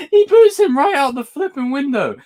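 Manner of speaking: high, wheezing laugh through the words; cry-laugh through it; words squeezed out between laughs; laughing while talking. Real, not acted. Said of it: "I'm crying, guys".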